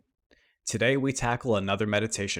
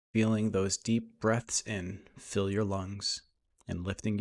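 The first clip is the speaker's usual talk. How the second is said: guided meditation; 5 dB quieter than usual. Acted. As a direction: lead them gently through breath and imagery, unhurried.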